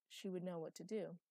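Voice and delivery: ordinary delivery, nothing marked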